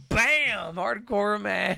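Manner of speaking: high, loud, slow ringside yell; mock announcer shout. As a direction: belt it out like a wrestling announcer. Retelling a wrestling match.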